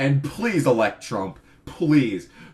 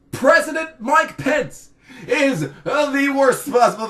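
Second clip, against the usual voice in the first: shouted warning; 12 semitones higher, 6 dB louder than usual. Acted. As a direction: yell it out like a doom siren. Shouted dread of Pence.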